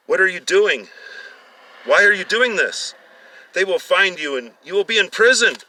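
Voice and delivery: high, loud panicked questions; frightened pleading. A narrator's reading. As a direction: demand answers in terror, tied to chairs. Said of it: "they settled down and said".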